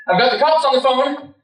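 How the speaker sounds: high-pitched threat called through a door; warning shout. Retold or imitated. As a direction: yell it to scare off intruders. Calls out to imagined intruders.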